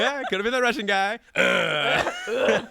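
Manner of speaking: loud, high mock imitation of that Russian guy; lightly voiced Russian, playful.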